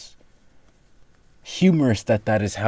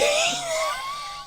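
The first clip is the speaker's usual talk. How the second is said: high squealing laugh. Real, not acted. Laughing hard mid explanation.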